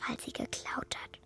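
soft unvoiced whisper; ashamed hushed confession. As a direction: breathe it out as a secret. Child confides a secret.

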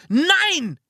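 high, sharp cry of protest; screamed refusal. A narrator's reading. Narrator refusing to open strange presents, screaming. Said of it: "schrie ich".

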